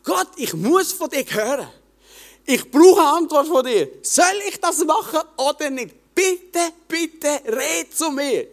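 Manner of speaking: high, desperate begging prayer; pleading with God. Retold or imitated. Young man begging God at his bedside, pleading high. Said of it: "Gott angefleht".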